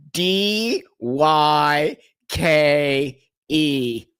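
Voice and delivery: very slow, letter by letter; spelling out. Real, not acted. Host spelling a banned word for viewers, slow and deliberate. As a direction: draw out each letter with a pause after it.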